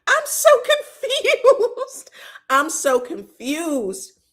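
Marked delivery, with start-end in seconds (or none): 0.1-2.1 high, slow and laughing through it; laughing confusion. Real, not acted. Baffled by the crying friends, laughs high and slow. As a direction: dissolve into giggles mid-line.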